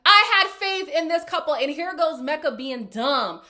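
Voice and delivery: fed-up loud complaint; irritated rant. Real, not acted. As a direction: vent your frustration at the screen. Ranting, irritated at a couple.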